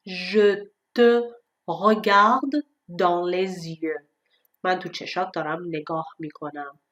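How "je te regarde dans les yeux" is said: "regarde dans les yeux" spoken very slowly; slow example. Shown as a demonstration. Teacher reading an example to students: slow. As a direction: read it slowly and carefully.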